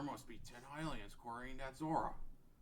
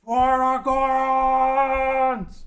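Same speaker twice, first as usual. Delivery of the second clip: long, drawn-out war cry, far higher and louder; battle cry; 12 semitones higher, 20 dB louder, much slower than usual. Acted. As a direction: bellow it slowly as you swing the hammer. Darunia's battle cry before striking.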